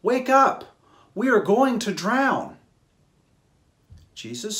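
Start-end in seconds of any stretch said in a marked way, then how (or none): 0.0-2.6 higher, louder panicked plea; frightened cry. A narrator's reading. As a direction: shout to wake a sleeping friend. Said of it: "they cried".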